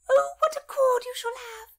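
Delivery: put-on high whispered squeak of a giddy old lady; hammed-up squeaky old mother. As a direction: squeak it out in delight. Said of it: "squeaked Lady Paget".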